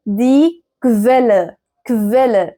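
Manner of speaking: Quelle starting with K plus V. Shown as a demonstration. Teacher modelling qu for learners, "kv" in Quelle. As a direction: say Quelle as "kvel-le", deliberately. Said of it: "ان ال او هنا بتتنطق في".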